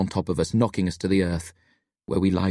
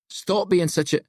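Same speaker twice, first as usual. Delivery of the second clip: higher-pitched, cut-off outburst; an understated scream, raised in pitch; mild mock scream; 11 semitones higher than usual. A narrator's reading. Billy snaps at his friends then falls, mildly screaming. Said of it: "Billy screams".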